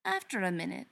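plain reading voice

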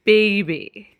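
put-on slurred, grinning drunk voice; drunk slurring. A narrator's reading. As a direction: slur it like you're tipsy. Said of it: "Keely slurs and grins".

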